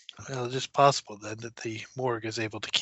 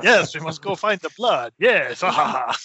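laughing Dracula impression, louder; hammed-up vampire through laughter; mock Dracula; 3 semitones higher, 6 dB louder than usual. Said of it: "you sound very Dracula-like".

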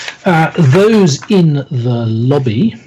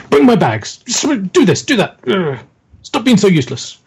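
higher-pitched, irritated string of orders; mock impatient scolding; 7 semitones higher than usual. Older man berating his young bag carrier, mock impatient. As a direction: scold like an exasperated boss, lightly acted.

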